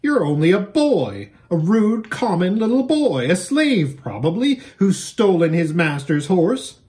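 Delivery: high, loud contemptuous scolding; scornful. Acted. Girl insulting Shasta as a slave, high and scornful. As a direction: look down on him hard. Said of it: "snapped the stranger".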